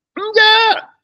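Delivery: high, loud squealed no; mock refusal. Believers answering God, high squealed mock refusal.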